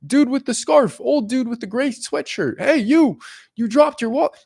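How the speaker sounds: loud, high-pitched call to get attention; shout. Acted. Shouting after a dude who dropped his wallet, urgent call. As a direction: holler after someone down the street. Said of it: "You're gonna shout".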